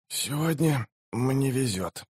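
slow, soft voice of a dying man; dying mage. A narrator's reading. Dying wounded mage speaks. (медленный тихий голос умирающего мага)

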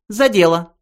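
high, businesslike call to work; lightly voiced mouse getting down to business; brisk mouse voice. A narrator's reading. Benji calling everyone to business. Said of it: "произнёс Бенджи".

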